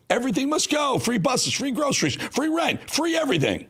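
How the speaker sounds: high, hyped late-night TV pitch; infomercial pitchman. Retold or imitated. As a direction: sell it like a cheesy infomercial. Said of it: "like a bad nineties late-night infomercial".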